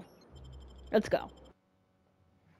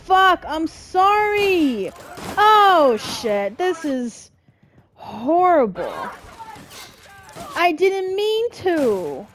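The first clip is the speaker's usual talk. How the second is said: panicking gamer; 7 semitones higher, 3 dB louder than usual. Real, not acted. Combat chaos, apologizing to NPCs.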